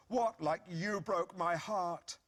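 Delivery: slow, high, hammed-up heartbroken jab; milk the line for melodrama; wounded retort. Husband delivers his rehearsed line to his wife, slow hammed-up heartbreak. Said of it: "I was particularly pleased with that line".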